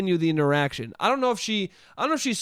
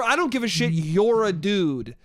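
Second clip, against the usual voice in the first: "You're a dude" slowed right down; DUDE as name-calling. Shown as a demonstration. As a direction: drag out "dude" as a deliberate mock insult. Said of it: "that would be me insulting her".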